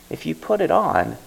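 secretive whispered hint. Acted. Old man whispering the mask's secret to Bae.

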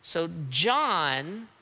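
ordinary talk

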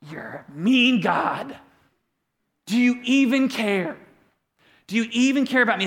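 complaining to God on a bad day, mock sulky; mock sulky accusation. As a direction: grumble it at God like a hurt child. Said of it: "And I'm like".

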